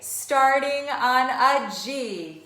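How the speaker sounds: slow, loud chanted cheer; cheerleader cheer. Pretend. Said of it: "my cheerleader cheer at the beginning".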